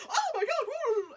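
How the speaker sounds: very high, loud flamboyant gasp; hammed-up shriek of surprise; mock swooning shock. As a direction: imitate an over-the-top fainting man. Imitating man's surprised faint.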